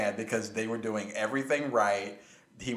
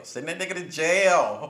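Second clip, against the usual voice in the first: emphatic outburst; 6 semitones higher, 5 dB louder than usual. Real, not acted. Heated talk about jailing officials.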